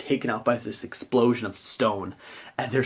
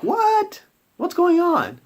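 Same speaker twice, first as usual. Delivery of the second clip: very high, loud squeal of confusion; comic high-pitched bewilderment; baffled outcry; 5 dB louder, much slower than usual. Retold or imitated. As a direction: imitate stunned players protesting. Players' retold confusion.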